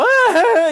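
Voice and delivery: put-on high wailing kid's "ah"; short mock child wail; little kid cry. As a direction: mimic a small child bursting into a cry. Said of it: "like a little kid cry".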